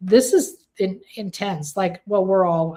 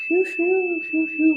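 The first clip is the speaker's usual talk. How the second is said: high airy "phew" puffs; whooshing exhale; 11 semitones higher than usual. Real, not acted. Reader clearing the energy here again, high whooshing puffs. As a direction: blow the energy away in quick high whooshes.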